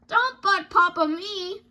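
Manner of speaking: hammed-up parent scolding; mock scolding. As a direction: wag a finger with every word. Papa scolds cub.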